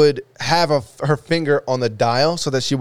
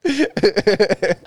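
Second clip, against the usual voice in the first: slow, higher, breaking into laughs; laughing; 7 semitones higher, much slower than usual. Real, not acted. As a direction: draw it out between bursts of laughter. Cracking up over being tempted.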